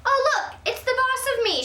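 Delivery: high, loud sarcastic jab; grouchy. Acted. Mother grumbling as grandma walks in, sarcastic and loud. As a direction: sneer at the newcomer. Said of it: "kind of grouchy".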